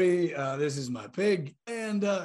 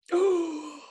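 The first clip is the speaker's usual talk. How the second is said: sharp gasp; 13 semitones higher, 4 dB louder than usual. Acted. Player reacts to awareness check.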